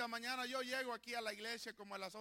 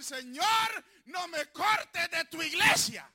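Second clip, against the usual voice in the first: high, loud shouted plea; shouted prayer; 6 semitones higher, 11 dB louder, slower than usual. Shown as a demonstration. Pastor leading the church in a shouted prayer, loud and high. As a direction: cry it out to heaven. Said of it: "puede gritar".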